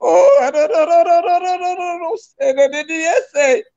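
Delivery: high, crying, repeated pleading no; sobbing plea. Real, not acted. Wife crying out against her husband's words, sobbing and pleading. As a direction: beg through sobs, voice cracking high.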